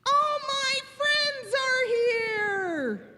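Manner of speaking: high, drawn-out squeal of delight; thrilled Piggy. Acted. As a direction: cry out slowly in overjoyed welcome. Piggy greets the arriving pigs.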